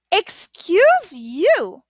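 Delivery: slow indignant "Excuse you". Shown as a demonstration. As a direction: drag it out, mock offended. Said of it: "also like this".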